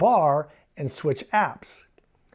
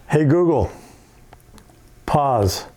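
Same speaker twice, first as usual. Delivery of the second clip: voice command; 4 dB louder, slower than usual. Shown as a demonstration. Commanding the voice assistant.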